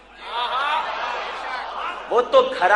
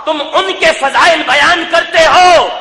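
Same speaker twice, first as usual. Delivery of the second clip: accusing challenge, climbing in pitch to a loud finish; impassioned sermon crescendo; fiery preaching; 5 semitones higher, 4 dB louder than usual. Real, not acted. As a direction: build to an outraged rhetorical question. Preacher challenges, building to climax.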